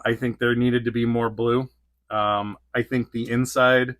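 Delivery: normal talking voice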